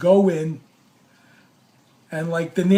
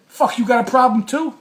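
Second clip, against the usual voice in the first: loud, raised-pitch retold challenge; bluffing challenge; 6 semitones higher, 6 dB louder than usual. Storyteller calls the cameraman's bluff, bluffing challenge. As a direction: square up to him, putting on bravado. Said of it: "I'm calling his bluff".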